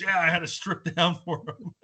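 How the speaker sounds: high, laughing through it; amused retelling of a laughing friend; chuckling as he quotes the naked friend. Retold or imitated. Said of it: "he just starts laughing".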